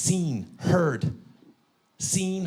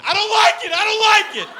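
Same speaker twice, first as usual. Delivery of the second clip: put-on much higher, loud kid shout; scared kid; 10 dB louder than usual. Son tipped over in car seat, high loud panicked yell. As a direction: wail it from the back seat. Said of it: "hear him from the back going".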